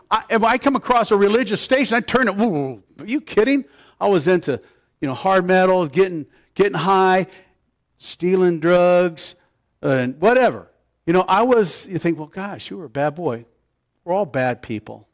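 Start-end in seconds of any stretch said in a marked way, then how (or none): 2.4-3.6 mock incredulous scoff, pitched up. Retells rejecting religious radio. 12.2-13.5 soft imitation of a listener's thought; hushed mock judgement; imagined murmured reaction.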